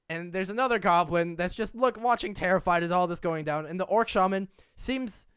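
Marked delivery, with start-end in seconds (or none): none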